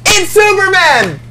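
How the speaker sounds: high loud frightened yell; panicked shout. Pretend. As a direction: flee in terror, screaming. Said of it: "Now do Superman".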